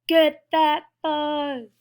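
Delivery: slow, loud, stretched-out yell; drawn-out battle cry. Acted. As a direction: stretch every word into a charge. Acted fight over fuzz, drawn out.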